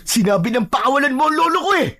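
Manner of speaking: high, fast, exasperated yell; angry shout. Acted. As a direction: shout the demand in desperate fury. Repeats demand to free grandfather. (galit na sigaw na nag-uutos)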